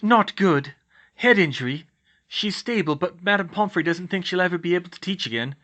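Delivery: put-on high young woman's voice, troubled; earnest, sorrowful report; Hermione, grave. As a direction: break bad news in a high voice.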